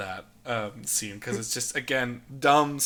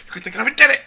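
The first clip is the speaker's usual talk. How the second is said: Rey's frantic yell; 10 semitones higher, 9 dB louder, much faster than usual. Retold or imitated. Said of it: "He's like".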